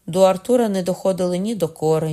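usual speaking voice

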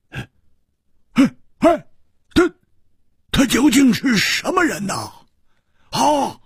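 put-on fretful young woman, pitched high and urgent; anxious high female voice. Butterfly Flower blurts out her worry, anxious high voice.

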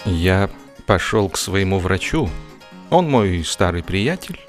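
ordinary talk

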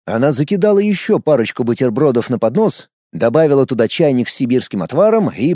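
usual speaking voice

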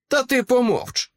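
high-pitched, snappish order; irritated silencing. Acted. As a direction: cut them off with irritation. Villain silences the interrupter. (роздратовано затикає рота)

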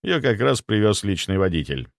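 usual speaking voice

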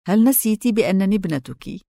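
ordinary delivery

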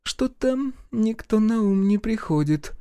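stumped, high and slow; puzzled woman character. A narrator's reading. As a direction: draw it out while searching for a name. Heroine thinking aloud, stumped.

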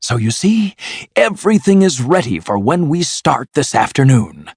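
high, eager announcement; excited. A narrator's reading. Toad tells Mole the plan, talking excitedly. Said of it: "still talking excitedly to the mole".

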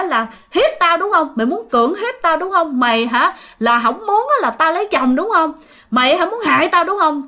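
high, hammered accusations; retold angry ranting. Cousin accusing the visitor of bad intentions, high angry ranting. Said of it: "cứ nói rằng á, là".

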